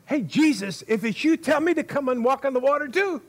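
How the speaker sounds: high, quick, over-keen calling out; mock eager shout. Peter hails Jesus walking on water, comically eager. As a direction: call out fast and high like an impulsive volunteer.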